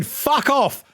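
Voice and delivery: high, loud fuck off at Matthew; loud yell. Real, not acted. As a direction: bark it out as a joke. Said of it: "that was really loud".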